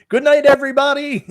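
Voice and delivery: laughing high and loud goodnight. Real, not acted. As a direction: burst out laughing as you say goodnight. Laughing sign-off.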